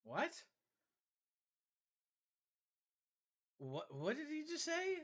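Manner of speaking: high incredulous what did he say; surprised what. Real, not acted. Incredulous at a game character.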